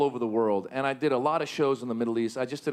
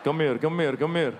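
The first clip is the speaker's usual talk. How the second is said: shady yogurt dealer. Retold or imitated. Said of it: "the guy's".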